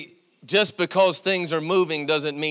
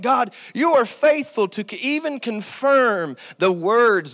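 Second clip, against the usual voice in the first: retold excited praise to God, slightly raised; 5 semitones higher than usual. Pastor retelling his prayer on confirmed scripture, excited praise. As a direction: exclaim it like a thrilled preacher. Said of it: "I began to get excited".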